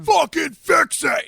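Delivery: imitating a furious bandmate yelling; high, loud, drawn-out demand; angry shout. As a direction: bellow it like the enraged singer. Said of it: "he's like".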